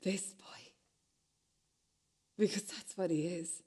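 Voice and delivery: soft whispered; voiceless, soft. Acted. Whispered stage lines.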